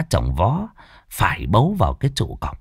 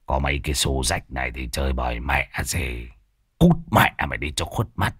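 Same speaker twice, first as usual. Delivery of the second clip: low scornful grumble, much softer; contemptuous sneer; 9 semitones lower, 5 dB quieter than usual. Acted. Old Thoạt dismissing Hiệp's crumpled cash, low contemptuous sneer. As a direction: sneer it out through a pout. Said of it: "Lão Thọt cay cú phát tiết, bĩu môi".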